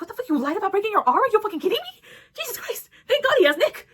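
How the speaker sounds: high, loud, disbelieving rant; incredulous outburst. Retold or imitated. Reader reacting to Andrew hiding his broken arm, incredulous yelling. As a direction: shout your disbelief at the page. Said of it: "And I'm just like".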